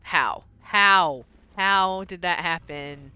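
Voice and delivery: loud, stunned how; baffled, almost shouting; loud disbelief. Real, not acted. Can't explain the one-handed mace.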